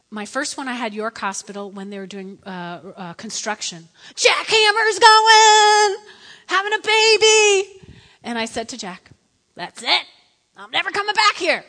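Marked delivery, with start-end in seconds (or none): 4.2-7.8 high, drawn-out full-voiced yell; loud, stretched-out high shout; shouting over noise. Acting birth amid construction noise. 9.5-11.6 raised, higher voice; mock outburst to husband; emphatic vow. Retelling her vow to Jack.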